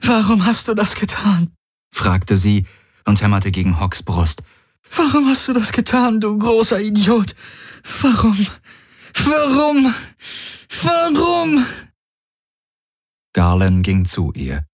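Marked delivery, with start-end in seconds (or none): 0.0-1.5 high, anguished wail; wailing in grief. A narrator's reading. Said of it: "Kirai heulte vor Schmerz auf". 4.9-11.7 very high wail, climbing with each cry; wailing in grief. A narrator's reading. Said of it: "Kirai heulte vor Schmerz auf".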